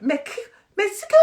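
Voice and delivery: high-pitched, loud mock regional accent; attempted impression of James's accent. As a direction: squeal it in a badly faked accent. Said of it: "James has a particular accent".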